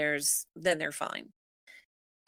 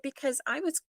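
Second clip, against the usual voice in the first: retold protest; 7 semitones higher than usual. Retold protest to ultrasound technician.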